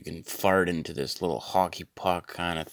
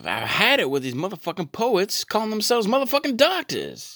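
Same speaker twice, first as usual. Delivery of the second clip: much higher, louder snake-movie rant voice; Samuel L Jackson impression; 5 dB louder than usual. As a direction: belt it like Samuel L Jackson fed up. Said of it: "bang-on impression of Samuel L Jackson".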